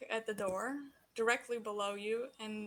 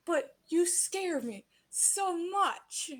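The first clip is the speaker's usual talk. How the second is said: high, slow emotional plea; mom's heartfelt, higher-pitched reproach; worried mother; 7 semitones higher, much slower than usual. Acted. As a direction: stress the fear, drawing it out. Worried mom confronts her child.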